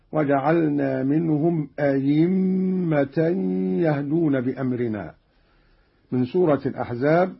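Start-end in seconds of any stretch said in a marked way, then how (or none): 0.1-5.1 أئمة said a-yimma, with a pure y; hamza turned to ya. Shown as a demonstration. Teacher shows Qalun's second way, أئمة with a pure ya. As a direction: replace the middle hamza of أئمة with a clear y. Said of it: "أو إبدالها ياء خالصة".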